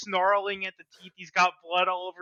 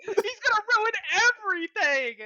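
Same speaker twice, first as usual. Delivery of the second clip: much higher and louder, crying shout; panicked wail; 13 semitones higher, 4 dB louder than usual. Acted. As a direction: wail in mock despair as the enemy returns. Voicing Gretel seeing Nier return.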